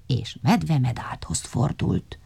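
ordinary delivery, nothing marked

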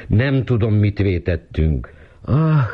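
high, drawn-out sighing lament; plaintive, slowing into a sigh; sighing complaint. A narrator's reading. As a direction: sigh it out, high and long-suffering. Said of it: "sóhajtott".

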